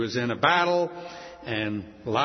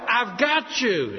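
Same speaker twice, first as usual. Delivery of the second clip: slow, louder joyful cry; overwhelmed with joy; 6 semitones higher, 4 dB louder, slower than usual. Retold or imitated. Mary Magdalene hugging Jesus, slow overjoyed cry. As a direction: hold on and never let go. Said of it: "so overwhelmed with joy".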